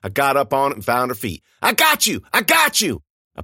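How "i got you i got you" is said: high, loud effortful cry; straining shout. A narrator's reading. Narrator straining to lift Frankie, loud and high. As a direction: shout while heaving up.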